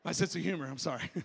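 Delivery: normal talking voice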